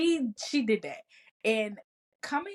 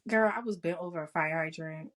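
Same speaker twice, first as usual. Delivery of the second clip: ordinary talk, nothing marked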